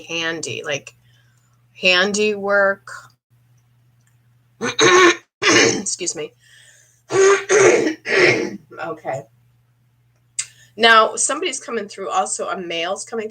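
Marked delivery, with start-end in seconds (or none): 4.6-5.2 real, forceful throat clear. Real, not acted. Psychic getting ready to channel, clearing her throat. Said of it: "start clearing my throat". 7.1-8.6 real, forceful throat clear. Real, not acted. Psychic getting ready to channel, clearing her throat. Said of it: "start clearing my throat".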